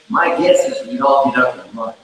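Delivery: usual speaking voice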